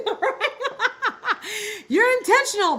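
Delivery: laughing through the words. Real, not acted. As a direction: laugh it out, amused at the joke. Laughs at dating joke.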